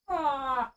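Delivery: sharp high cry of pain; pained yelp. Real, not acted. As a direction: yelp from a stubbed toe. Just stubbed toe, cries out.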